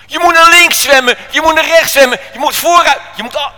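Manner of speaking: high, loud, urgent yelling; shouted directions. Acted. Crew directing the man to the lifebuoy, shouting high. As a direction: yell commands to someone far off.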